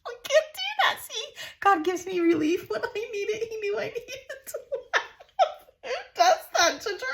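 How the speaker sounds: high, breathless laughing through the words; can't stop laughing at her own slip; helpless giggling. Real, not acted. Crafter cracking up over letters on her friend's photo, laughing. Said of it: "now I'm tearing up".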